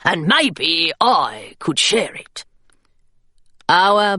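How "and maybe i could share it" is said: a teasing gargoyle creature voice; wry little gargoyle, dry and teasing. Acted. Gargoyle teasing Hilary about a medal, dry creature voice. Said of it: "said the gargoyle".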